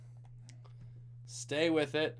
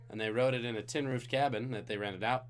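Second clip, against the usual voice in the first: ordinary delivery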